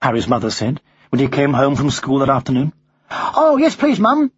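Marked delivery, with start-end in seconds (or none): 3.1-4.3 high-pitched schoolboy voice; young boy. Acted. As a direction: accept eagerly as a young boy. Boy answers his mother.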